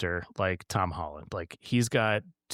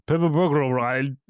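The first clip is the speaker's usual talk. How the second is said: "Purple burglar alarm" mangled in a thick mock Scottish accent, louder and higher; 5 semitones higher, 5 dB louder than usual. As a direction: stumble through "Purple burglar alarm" like a Scot who can't get the syllables. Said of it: "Scottish people just cannot say it. They just go".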